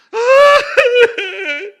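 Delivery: a burst of high, loud laughter. Real, not acted. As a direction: break into a big, loud laugh. Preacher laughs mid-sermon. (carcajada fuerte y aguda del predicador en pleno sermón)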